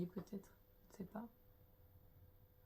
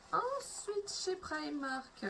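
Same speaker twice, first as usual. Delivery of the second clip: high, drawn-out playful announcement; sing-song announcing; 8 semitones higher, much slower than usual. Real, not acted. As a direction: stretch it out like a show host. Announcing next haul section.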